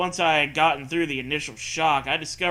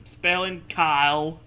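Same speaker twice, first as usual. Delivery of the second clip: mock glitchy voice; 4 dB louder, slower than usual. Mock-reading the garbled text.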